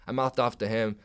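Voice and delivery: ordinary delivery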